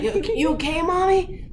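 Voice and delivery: put-on kids' concerned voice. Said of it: "with them like".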